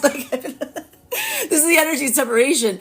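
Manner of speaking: high, fast laughing talk. Real, not acted. Laughing about the separated energy. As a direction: laugh it out quickly.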